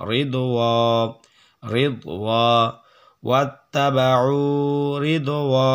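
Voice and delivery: "ওয়া" with a long-held vowel; one-alif madd. Shown as a demonstration. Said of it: "এক লিফ টান".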